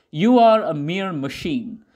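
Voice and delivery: ordinary delivery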